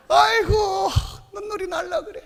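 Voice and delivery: ordinary delivery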